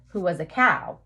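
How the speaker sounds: plain reading voice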